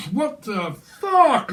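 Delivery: drawn-out high stunned reply; slow baffled disbelief. Retold or imitated. Reader voicing the partner's stunned reply, slow and high. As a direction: stretch it out in pure disbelief.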